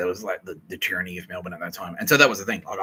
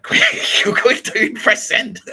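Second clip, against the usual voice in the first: high, loud and laughing; laughing urgency; 12 semitones higher, 11 dB louder, slower than usual. Retold or imitated. Kitty urging him to send, high, loud and laughing. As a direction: hurry him, cracking up. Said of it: "Kitty Callahan was like".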